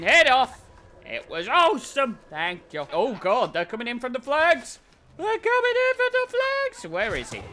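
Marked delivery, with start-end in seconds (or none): 5.2-6.9 very high, squeaky put-on voice; silly high voice. Player echoing his own warning about the flags, silly high voice. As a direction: repeat it in a mock falsetto.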